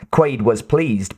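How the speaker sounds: plain reading voice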